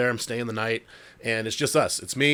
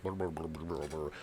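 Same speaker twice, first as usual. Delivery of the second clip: deep, much softer brr brr mumble; low mumbling; 8 semitones lower, 13 dB quieter than usual. Retold or imitated. Imitating the unseen thing mumbling in the back, low. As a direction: imitate an unintelligible low murmur. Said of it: "like, mumbling".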